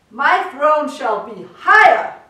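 loud booming decree; thundering. A narrator's reading. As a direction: thunder it like a tyrant. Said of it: "his royal voice thundered".

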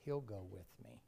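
quiet, tender acted reassurance; hushed reassurance. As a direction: gently comfort a parent, nearly whispering, with long pauses. Retold dying girl reassures her father.

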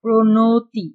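loud প্রণতি with a full o after the ণ; middle vowel as o. Shown as a demonstration. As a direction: lean on the "no" in প্রণতি. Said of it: "এটা ও এর মতো উচ্চারণ হয়েছে". (জোরে, মাঝের অ-কে ও করে "প্রণতি")

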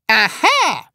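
loud, high gotcha cry; triumphant landlady exclamation; shouting. A narrator's reading. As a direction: pounce on it loudly. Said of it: "schrie sie".

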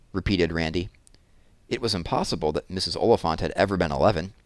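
usual speaking voice

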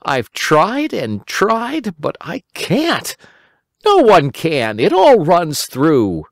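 put-on high, slow, despairing boy voice; a little boy giving up; Simple Simon. A narrator's reading. Simon complaining to Uncle Wiggily about the sieve, high boyish voice.

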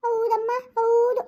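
sulky sarcastic yes; sarcastic whine. Acted. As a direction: drag it out in a whiny sarcastic yes. Sarcastic reply in sisters' quarrel.

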